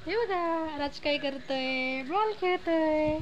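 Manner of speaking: slow, stretched words with lingering endings; sing-song baby talk. Pretend. Mother narrating baby's ball game, sing-song baby talk. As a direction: draw it out playfully for a little one.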